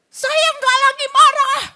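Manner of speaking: mock a man screaming he is calm; furious denial shout. A husband shouting at his wife that he is not angry, mock shout. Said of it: "Bapaknya teriak kepada istrinya".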